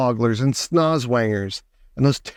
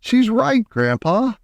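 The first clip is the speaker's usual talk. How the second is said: mildly acted high cry; Charlie crying out; 9 semitones higher, slower than usual. A narrator's reading. Child telling Grandpa she's right, lightly acted excited cry. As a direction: lift the pitch in amazed agreement. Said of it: "cried Charlie".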